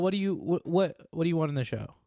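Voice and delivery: normal talking voice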